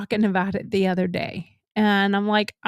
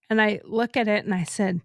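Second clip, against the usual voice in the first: ordinary talk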